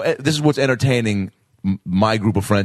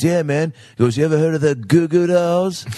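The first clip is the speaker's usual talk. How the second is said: put-on higher, slurred drunk rocker; 6 semitones higher than usual. Drunk singer asks the teen about his band, higher. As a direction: lightly play a wasted star dropping his band name. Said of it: "making him sound like a drunk [name]".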